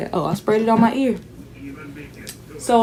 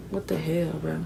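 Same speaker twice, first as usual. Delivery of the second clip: annoyed under breath; 5 semitones lower, 14 dB quieter than usual. Retold or imitated. Mad about dirty icy whites.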